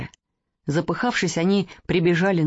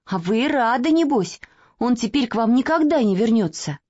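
put-on reproachful little girl; 5 semitones higher than usual.

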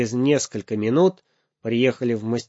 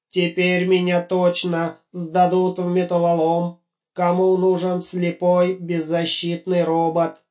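despairing robot; 7 semitones higher than usual. Acted. Blind robot laments his fate.

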